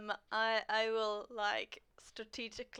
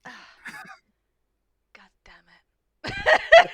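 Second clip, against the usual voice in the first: hushed cursing; 17 dB louder, much slower than usual. Acted. Player's character reacting to a failed insight check, hushed cursing. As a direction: curse slowly under your breath. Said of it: "I'm like".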